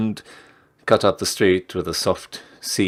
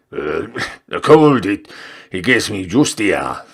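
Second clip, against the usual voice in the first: put-on old man voice; 6 dB louder than usual. Reader voices old customer.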